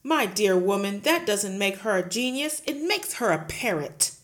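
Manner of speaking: loud, booming headmistress; bully the teacher at full volume; Trunchbull bullying. Acted. Headmistress roaring at Miss Honey, loud and bullying.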